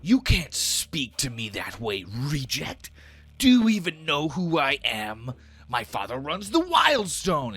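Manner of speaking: indignant outrage. Acted. Bully boasts of his father to snow giant, indignant. Thren outraged at being challenged.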